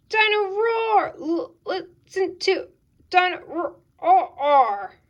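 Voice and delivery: loud, high roar stuttering to a halt; toy breaking down. Acted. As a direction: stammer it in broken, slowing chunks. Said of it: "Dino Roar stops working and talking completely".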